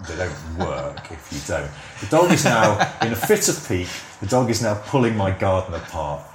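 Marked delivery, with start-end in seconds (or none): 2.0-6.2 louder and higher, fed up with the dog; real irritation as the dog tears up the garden; annoyed owner complaining about the dog digging; exasperated outburst at the dog. Real, not acted. Dog wrecking garden mid-podcast.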